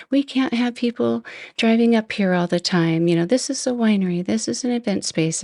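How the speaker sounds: high-pitched scolding without real volume; mock yelling. Winery woman telling drivers off at her event space, mock yelling. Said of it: "you know, this is her".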